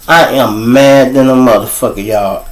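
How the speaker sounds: ordinary delivery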